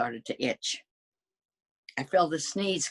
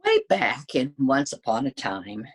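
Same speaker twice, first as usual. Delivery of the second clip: ordinary delivery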